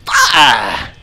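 sharp high scream; startled scream. Real, not acted. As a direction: yelp at the jump scare. Jump scare in game.

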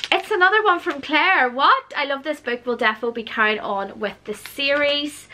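ordinary delivery

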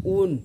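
ऊन with a long oo; ऊन, vowel held before the n; drawn out. Shown as a demonstration. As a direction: stretch the oo in ऊन a little. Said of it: "थोड़ा खींच के बोल रहे हैं".

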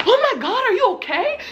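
high-pitched mock alarm; imitated alarmed worker. Ride worker checking on a soaked rider, imitated alarm. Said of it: "the worker was like".

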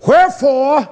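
high, loud demand of God; acted loud complaint. The people challenge Yah over fasting, acted loud complaint. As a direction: cry it out as an indignant preacher's quote. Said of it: "Now look how they talk to Yah".

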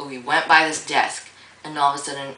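normal talking voice

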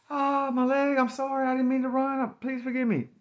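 high, pained acted begging; re-enacted high-pitched wail of apology; pleading apology. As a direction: beg forgiveness while hurting. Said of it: "asked the dude for some sincere help".

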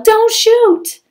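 high loud panicked yell. A narrator's reading. Cindy yelling at the godmother not to fire, high panicked. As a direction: cry out to stop her. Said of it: "and Cindy yelled".

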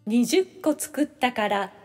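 usual speaking voice

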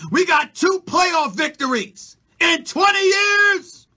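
slow, loud, hammered rant; ranting shout. Real, not acted. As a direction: pound out each word in outrage. Ranting about Cowboys playoff record.

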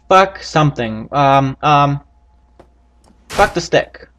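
normal talking voice

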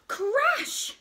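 loud, high exclaimed crash; sudden high-pitched crash; dramatic crash. A narrator's reading. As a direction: burst out with the sound effect. Chair breaks in the story.

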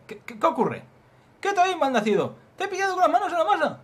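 high yell, starting in a stammer; acted alarmed shout. Startled man catching a thief red-handed, loud shout. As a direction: burst out shouting at the culprit.

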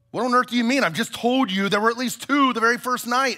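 incredulous protest. A narrator's reading. Shasta disputing the voice about the lions, incredulous.

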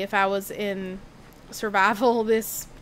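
ordinary talk, nothing marked

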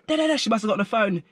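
plain reading voice

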